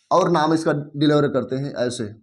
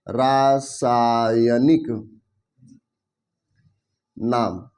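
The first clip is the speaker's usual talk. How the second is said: रासायनिक stretched out slowly, syllable by syllable, and lower; drawn-out dictation; 6 semitones lower, much slower than usual. Shown as a demonstration. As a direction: spell out रासायनिक slowly for the class to write. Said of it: "रासायनिक नाम कहते हैं". (रासायनिक को धीरे-धीरे खींचकर बोलना)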